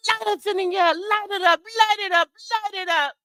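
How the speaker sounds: very high-pitched Jamaican hype chant; Jamaican dancehall. Acted. Host playing DJ, high Jamaican hype calls. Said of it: "go into a Jamaican dance".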